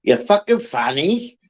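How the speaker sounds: a hammed-up Scottish accent, drawled out on fine; playful Scottish woman impression with a laugh; mock Scottish woman. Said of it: "three things you can hear off a Scottish woman".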